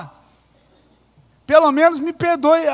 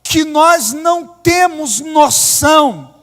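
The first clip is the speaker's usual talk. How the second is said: loud, slow, emphatic sermon; fervent preaching; 8 dB louder, slower than usual. Acted. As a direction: preach it hard, hammering each phrase. Preaching at full force.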